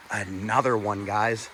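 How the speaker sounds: ordinary delivery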